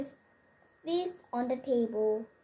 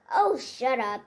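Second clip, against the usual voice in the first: loud dismissive snap; wife yells at the little man; rude yell; 7 dB louder than usual. A narrator's reading. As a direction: brush him off rudely. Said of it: "yelled Bunny".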